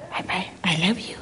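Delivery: soft unvoiced whisper to the bird; whispered I love you. Real, not acted. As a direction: breathe it tenderly.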